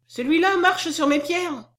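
put-on high, slow child's voice; high-pitched, drawn-out protest; child's complaint. A narrator's reading.

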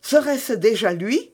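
high, eager rising question; excited cry. A narrator's reading. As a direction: exclaim in sudden hope. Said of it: "s'écria madame Bonacieux en s'élançant à la fenêtre".

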